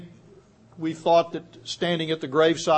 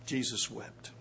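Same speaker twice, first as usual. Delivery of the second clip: hushed; 6 semitones lower, 14 dB quieter than usual. Real, not acted. Repeated quiet punchline.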